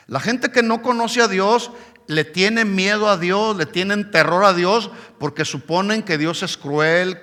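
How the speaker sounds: ordinary talk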